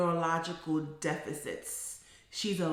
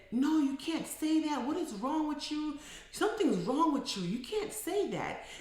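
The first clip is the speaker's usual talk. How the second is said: higher-pitched imitation of scolding; retold scolding; 8 semitones higher than usual. Boyfriend scolding his girlfriend for a slur, mildly acted scolding. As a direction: act out a mild telling-off. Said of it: "her boyfriend started to scold her".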